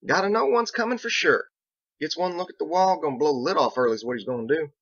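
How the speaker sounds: a backwoods lawman fretting out loud; Biggs. Acted.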